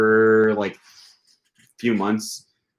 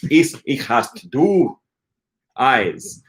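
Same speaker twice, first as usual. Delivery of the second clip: slow, higher-pitched imitation of a teacher; slow demonstration; 3 semitones higher, much slower than usual. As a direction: draw each word out for beginners. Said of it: "does it slowly".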